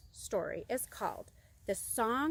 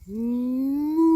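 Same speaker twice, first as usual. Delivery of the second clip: long, loud moo rising steadily; shouted moo; 2 semitones higher, 9 dB louder than usual. Acted. Little bird shouting for a friend, long loud moo. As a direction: bellow a drawn-out rising moo. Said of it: "So he shouted".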